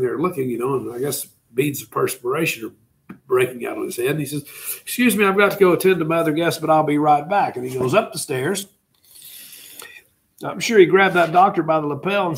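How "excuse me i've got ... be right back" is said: hurried excuse. Retold or imitated. Yusupov hurrying off upstairs.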